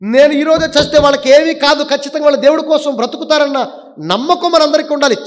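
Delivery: high, emphatic declaration of faith; forceful preaching. Real, not acted. Preacher voices faith for the family left behind, forceful preaching. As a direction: proclaim it with full conviction.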